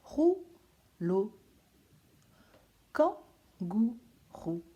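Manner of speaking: kangourou drawn out slowly, syllable by syllable. Shown as a demonstration. Teacher to pupils, spelling out syllables slowly. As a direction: stretch each syllable of kangourou. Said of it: "Quelle est la syllabe qu'on entend".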